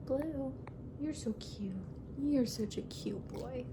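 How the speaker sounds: quiet cooing to a pet; soft dog talk. Real, not acted. Talking softly to her dog Blue, tender cooing. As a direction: coo it softly like to a sweet pup.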